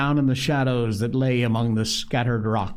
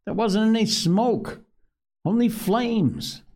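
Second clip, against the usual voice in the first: higher, lightly voiced boy; indignant young boy, pitched up; boy's protest; 10 semitones higher than usual. A narrator's reading.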